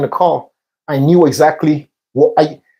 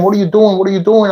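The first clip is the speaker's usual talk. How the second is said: higher-pitched imitation of a puzzled friend; retold bewildered protest; 4 semitones higher, faster than usual. Friend to the man searching him, retold bewildered protest.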